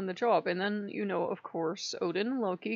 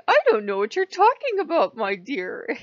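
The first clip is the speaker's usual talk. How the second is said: high, louder mock-innocent god; Odin playing innocent; 5 semitones higher, 9 dB louder than usual. Odin denying the developer threat, mock innocent. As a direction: feign innocence, a bit too loudly. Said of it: "And he's going".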